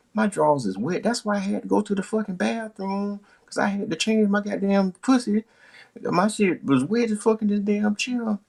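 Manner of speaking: high, fed-up woman ranting; high exasperated woman. Retold or imitated. Girlfriend complaining she's waited forever, high and fed up. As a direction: vent it all in her high voice. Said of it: "She said".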